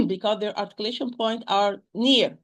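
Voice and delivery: ordinary talk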